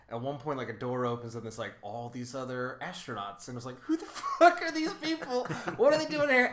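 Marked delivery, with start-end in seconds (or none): 3.8-6.5 high, half-laughing baffled cry; mock bewilderment. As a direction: act baffled at the strangers. Mock reaction to extras.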